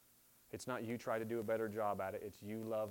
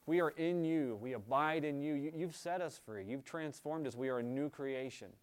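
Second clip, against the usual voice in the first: fervent prayer; 3 semitones higher, 6 dB louder than usual. Acted. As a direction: pray out boldly over the congregation. Opens the closing prayer.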